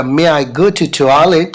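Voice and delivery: slow, careful student request; slow model request. Shown as a demonstration. Teacher models the student asking for the toilet. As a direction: stretch it out for repetition. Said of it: "आप बोलिएगा".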